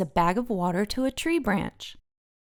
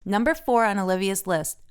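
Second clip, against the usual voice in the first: plain reading voice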